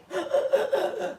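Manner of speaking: a sharp breathless gasp, imitating her; imitated deep gasp. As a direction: suck air in hard like someone coming back to life. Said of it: "she just gave this deep breath".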